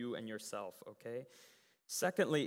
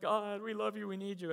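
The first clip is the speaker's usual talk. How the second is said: higher-pitched mock worship plea; fervent pleading; 6 semitones higher than usual. Team trying everything in worship, fervent pleading. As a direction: imitate earnest crying out to God.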